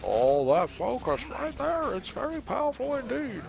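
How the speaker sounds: very high, slow, drawn-out character voice; silly high voice. Pretend. Gamer commenting on powerful focus, silly high voice. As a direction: climb into a squeaky put-on voice.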